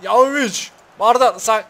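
high, loud burst of excitement; high loud excited calling out; excited shout. Real, not acted. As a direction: cheer the attack on. Excited at attack in game.

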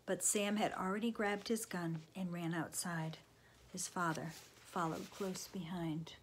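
ordinary delivery

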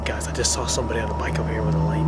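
fast urgent whisper. Real, not acted. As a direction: whisper so security won't hear. Spotting security while trespassing.

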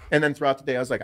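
ordinary delivery

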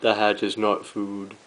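slow, measured repeat. Real, not acted. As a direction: spell it out slowly and low. Repeats the telling-off slowly.

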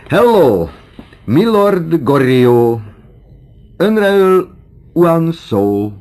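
very high, loud, slow drawn-out call; teasing hail. Acted. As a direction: sing out a mock-English greeting to the old man. Teasing hail in mock English. (magas, hangos, elnyújtott csúfolódó köszöntés)

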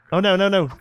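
high, loud burst of dismay; alarmed. Real, not acted. Player reacting to a game mishap, alarmed.

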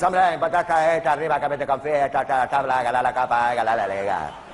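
loud, garbled mumbling footballer voice; dim footballer. Acted. Comedian mocking a footballer's post-match interview, mumbling loudly. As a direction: slur the clichés like a dazed player.

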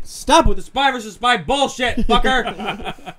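high loud slow yell; angry yell. Real, not acted. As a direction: yell at the enemy. Yelling at game.

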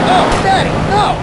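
mock alarmed cry. Mock plea to father.

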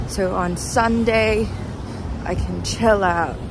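ordinary delivery

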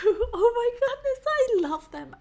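high-pitched, overwhelmed fan; high swooning fan outburst, easing down; emotional gushing. Real, not acted. Overcome by favourite bands' song.

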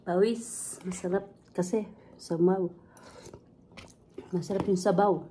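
talking with spoon at lips; slurping broth while talking; speaking between sips of soup. Real, not acted. Seen 2: Spoon of broth raised to lips and sipped between spoken phrases.

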